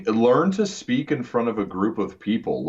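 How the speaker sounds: ordinary talk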